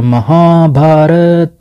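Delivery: Mahabharat stretched slowly, syllable by syllable; drawn-out word. Shown as a demonstration. Teacher writing the range name on the map, Mahabharat drawn out slowly. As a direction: draw out each long vowel of Mahabharat.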